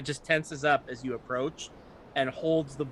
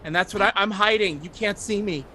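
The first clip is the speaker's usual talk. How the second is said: put-on frightened child; 6 semitones higher, 6 dB louder than usual. Scared child NPC hiding.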